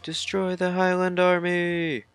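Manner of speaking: high, put-on soldier's battle cry; rally the troops in a high voice.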